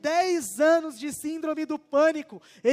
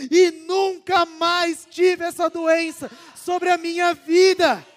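raised, ringing testimony, close to a shout; impassioned testimony; 4 semitones higher, 5 dB louder than usual. Retold or imitated. The driver tells Gustavo about his healing, near shouting. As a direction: proclaim the healing with full conviction.

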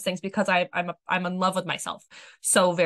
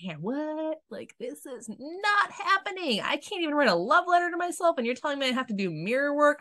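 incredulous refusal; 8 semitones higher than usual. Retold or imitated. To a friend suggesting mirror work, incredulous refusal. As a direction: act out recoiling from the idea.

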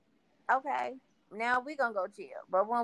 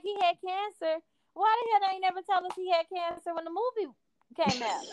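higher, fast outraged mock complaint; mock outrage, pitched up; high-pitched indignant quote; 10 semitones higher than usual. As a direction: squeak it out like a fan who feels betrayed. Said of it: "I'm like".

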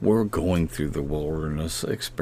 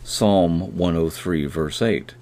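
ordinary talk

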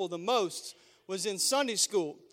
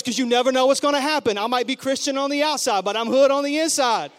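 tough mom boast; 3 semitones higher, 8 dB louder than usual. Retold or imitated. Mom's tough explanation.

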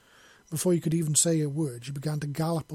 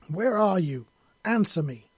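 loud, forceful demand; snarl; 4 semitones higher, 6 dB louder than usual. A narrator's reading. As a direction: snarl it at someone hiding. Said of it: "Yelena snarled".

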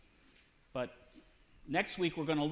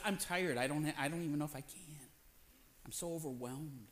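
weary; 2 semitones lower, 8 dB quieter than usual. Retold or imitated. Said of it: "sometimes it's just".

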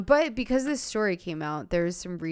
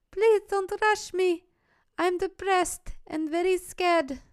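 much higher, hammed-up young woman's voice with an accent, slowed; a podcaster voicing Jana's messages in a high, mock-accented voice; put-on young woman voice; 12 semitones higher, 3 dB louder than usual. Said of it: "do the accent".

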